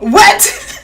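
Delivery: high, loud rising what; genuinely floored by the news; loud disbelieving what; shocked what. Real, not acted. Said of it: "that's my real reaction to it".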